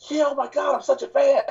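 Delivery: high, gushing starstruck fan voice; excited fan gush. Retold or imitated. Imitating an excited fan meeting him. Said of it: "She was like".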